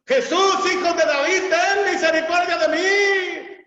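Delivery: very high, loud pleading cry; desperate shout. Acted. Blind beggar shouting to Jesus, desperate loud cry. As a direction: cry out to be heard over the crowd. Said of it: "Él gritaba tremendo".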